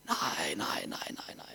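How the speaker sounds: soft unvoiced "nej" repeated; fading whisper of refusal; whispered panic. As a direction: whisper your dread under your breath. Thinks in panic.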